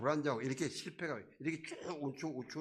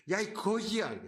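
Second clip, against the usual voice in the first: higher, louder sneering shout; contemptuous yell; 8 semitones higher, 5 dB louder than usual. Retold or imitated. Crowd jeering loudly at the blind beggar, contemptuous yell. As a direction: jeer at the beggar.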